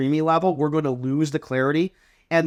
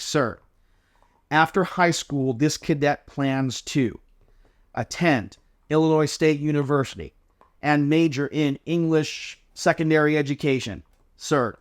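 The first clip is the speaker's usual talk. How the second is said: each chunk its own phrase, pause between; phrased with pauses. Shown as a demonstration. Cadet answering an inspector, pausing between phrases. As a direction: push a little then pause after each chunk. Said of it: "group our answer into phrases ... adding a pause".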